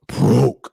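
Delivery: "broke" punched out with emphasis; "broke" said as a drawn-out, emphatic word. Retold or imitated. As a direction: lean hard on "broke", opening it forcefully. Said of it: "they said it with emphasis".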